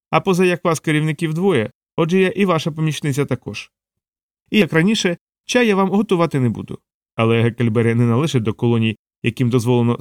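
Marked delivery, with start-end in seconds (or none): 0.1-6.8 higher-pitched, sharp-witted assistant; Savitri, sharp-witted assistant. Acted. Assistant setting terms with her boss, cheeky higher voice.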